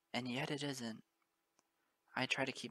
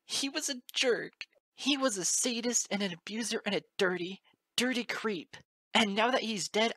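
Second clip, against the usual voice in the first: much higher, much louder anguished outburst; tearful shouting; 7 semitones higher, 8 dB louder than usual. Acted. Moon Dancer railing about her father, anguished shout. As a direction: scream the grief and rage out.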